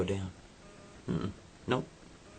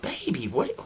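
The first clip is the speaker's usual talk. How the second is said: put-on girlfriend voice; 5 semitones higher than usual. Girlfriend reacting to his claim.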